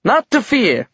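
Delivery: high-pitched nervous reassurance; frightened voice, pitched well up; fearful. A narrator's reading. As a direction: reassure while audibly scared. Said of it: "his voice demonstrating what fear sounded like".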